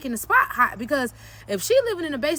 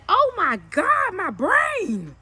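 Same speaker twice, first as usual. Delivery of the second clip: high, drawn-out mock astonishment; slow, pitched-up dramatic wail; overwhelmed exclamation; 5 semitones higher, much slower than usual. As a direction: cry it out as if your mind is blown. Acted reaction to so many lessons.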